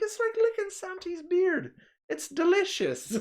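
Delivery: very high, slow mock Irish; Irish accent. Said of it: "speaking with an Irish accent".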